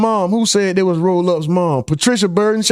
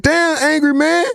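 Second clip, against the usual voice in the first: Martin teasing; 11 semitones higher than usual. Retold or imitated. Martin teasing Angry Man about the magazines, high mocking voice. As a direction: squeal it out like Martin clowning. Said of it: "Remember when Martin asked Angry Man".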